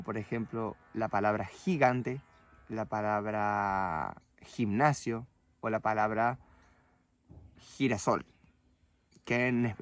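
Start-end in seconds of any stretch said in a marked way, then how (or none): 1.4-8.2 gigante, gimnasio, girasol with throaty /x/; Spanish strong g. Shown as a demonstration. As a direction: hit the g of gigante like a Spanish jota. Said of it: "El sonido más fuerte sería como". (gigante con sonido fuerte de jota)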